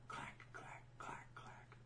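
whispered clacking footsteps; heel clacks. Retold or imitated. Voicing high heels walking away, soft whispered clacks. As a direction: tap out heels on the floor, softly. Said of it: "That's him walking away".

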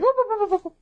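very high, fast whoop-whoop; Zoidberg whoop. Retold or imitated. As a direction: flail like a panicking crab alien. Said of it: "like a Zoidberg?".

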